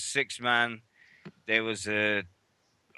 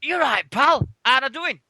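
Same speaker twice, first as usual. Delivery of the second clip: broad Sheffield greeting, higher and louder; Sheffield accent; 12 semitones higher, 5 dB louder than usual. Retold or imitated. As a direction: call out the name in a thick Sheffield accent. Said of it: "In a proper Sheffield accent".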